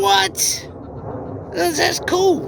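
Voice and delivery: high astonished exclamation; surprised. Real, not acted. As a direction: gasp it out in amazement. Host reacts in amazement.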